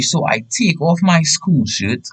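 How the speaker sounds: usual speaking voice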